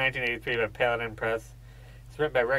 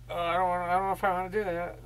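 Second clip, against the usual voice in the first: whiny higher-pitched reluctant voice; imitating a germaphobe; 8 semitones higher, faster than usual. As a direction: squeamish guy not wanting to touch it, mocked. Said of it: "and he's like".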